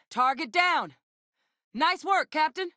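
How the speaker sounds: high-pitched call across the battlefield; combat call-out. Acted. Companion praising captain after a kill, calling out. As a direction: call it out over the fighting.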